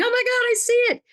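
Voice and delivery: high, fast gasp of wonder; imitating people seeing a miracle; amazed audience.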